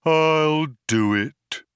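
martyred robot surrender, long drawn out; tolling cracked bell. A narrator's reading. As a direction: toll each word heavily. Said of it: "like the tolling of a great cracked bell".

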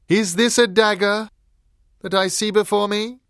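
loud, high stage actress voice; hammy Shakespearean question; theatrical declaiming. Acted. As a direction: project it grandly to the whole theatre.

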